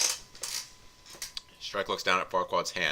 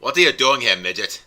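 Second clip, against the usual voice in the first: loud, higher, threatening growl; menacing ogre snarl; 4 semitones higher, 9 dB louder than usual. Acted. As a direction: bellow down at the little lord. Shrek threatens Farquaad menacingly.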